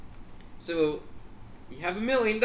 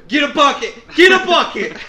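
urgent laughing cry for a bucket; frantic mock yell, much louder; mock panicked shout; 7 semitones higher, 12 dB louder than usual. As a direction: yell it louder and higher the second time. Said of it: "all of a sudden you go".

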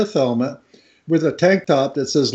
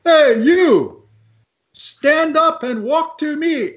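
retold scream; 10 semitones higher, slower than usual. Lifeguard cups hands to call drowning man, imitated holler. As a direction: imitate calling out across the water, slowly. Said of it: "and he screams".

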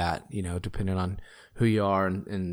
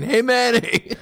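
silly voice; 14 semitones higher, 5 dB louder, slower than usual. Pretend. Joking greeting in silly voice.

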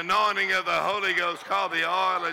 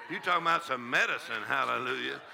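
ordinary talk, nothing marked

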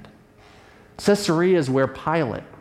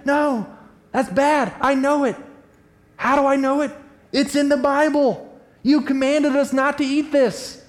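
much higher, emphatic refusal; Peter protesting; 9 semitones higher than usual. Retold or imitated. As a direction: insist you know the rules. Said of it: "Each time Peter's saying".

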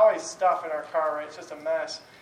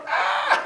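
put-on high, loud laughing yelp of a child; mock toddler squeal through laughter; 9 dB louder than usual. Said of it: "so she's just like".

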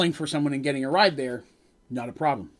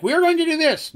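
high, loud proclamation; mock bold declaration; 12 semitones higher, 6 dB louder than usual. Husband declaring the plan at home, mock bold and loud. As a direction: announce it big, as if you are in charge. Boss declaring, then yielding to wife.

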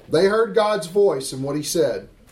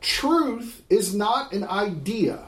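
slow weighty declaration; much slower than usual. Acted. Preacher stressing key claim.

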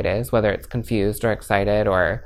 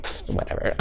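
quiet, breathy brush-off with a scoff; dismissive pfft; 6 dB quieter than usual. Retold or imitated. Shrugging off people pushing their buttons, dismissive. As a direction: wave it away like it's nothing. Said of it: "And now I'm like".

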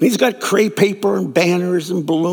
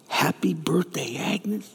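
hushed, whispered birthday wish; soft whisper; 2 semitones lower, 9 dB quieter, slower than usual. Acted. Diner crowd whispering a birthday wish to tearful Agnes. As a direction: breathe it gently so she isn't startled.

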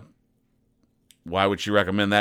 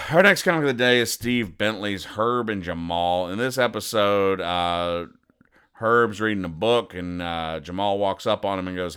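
ordinary talk, nothing marked

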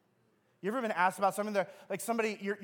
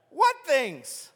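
much higher, louder, hammed-up clueless asking; mock innocent Jesus; 8 semitones higher, 8 dB louder than usual. Preacher mocks Jesus pretending not to know, hammed-up high voice. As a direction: play dumb, overdone for the joke.

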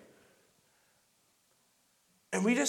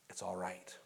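whispered reassurance; 12 semitones lower, 14 dB quieter than usual. Real, not acted. Pastor comforting the congregation, whispering that it's all right. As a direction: breathe it gently to them. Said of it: "whispered reassurance".

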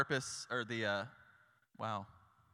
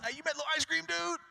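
much higher, bright hawking voice calling to passersby; eager street promoter; 14 semitones higher, faster than usual. Retold or imitated. Promoter outside the ice cream truck greeting passersby, much higher eager call. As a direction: hail everyone like a cheerful barker. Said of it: "ringing bells".

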